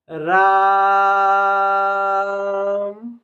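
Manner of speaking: long drawn-out call of Ram. Shown as a demonstration. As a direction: call Ram across a distance, stretching it. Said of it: "किसी को पुकारने का भी काम हो रहा है".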